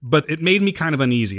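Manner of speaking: ordinary delivery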